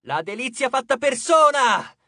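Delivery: high, drawn-out shout; shouted taunt. A narrator's reading. Percy flatters the goddess to enrage her, shouting. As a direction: cry out mock praise. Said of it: "gridò".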